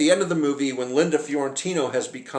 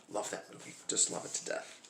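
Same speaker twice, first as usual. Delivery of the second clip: whispered aside; 4 semitones lower, 15 dB quieter than usual. Real, not acted. Murmured aside after movie quote.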